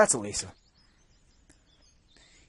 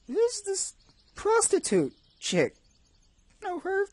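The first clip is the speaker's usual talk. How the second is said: high squeaky voice; 3 dB louder, slower than usual. Pretend.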